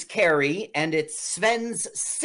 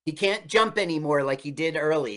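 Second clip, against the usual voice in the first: ordinary talk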